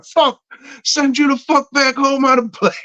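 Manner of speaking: high-pitched mock angry repeat; mock snap. Joking replay of snapping at Lori, high mock anger. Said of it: "I'm sorry. I love you".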